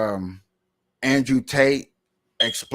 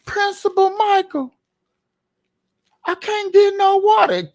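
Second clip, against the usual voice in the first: complaining student; 6 dB louder than usual. Acted. Student complains to principal about water, high mock whine. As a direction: squeal a loud complaint as a student. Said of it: "come to my office and talk about".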